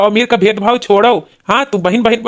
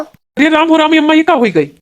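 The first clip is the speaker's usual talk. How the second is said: higher, alarmed outcry on finding her collapsed; shocked cry; 7 semitones higher than usual. Acted. Brother finding his fainted sister, alarmed cry.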